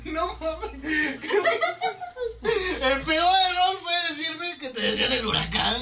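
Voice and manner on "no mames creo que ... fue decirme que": high, slow laugh-cry; laughing so hard. Real, not acted. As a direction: wheeze it out laughing. Laughing complaint.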